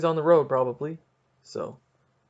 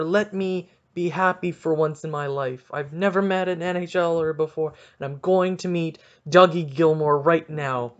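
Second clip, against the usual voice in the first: loud, high, gushing fan demand; hammed-up eager fan shouting; mock excited outburst; 4 semitones higher, 9 dB louder than usual. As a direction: blurt it out like a starstruck fan. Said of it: "I could have been like".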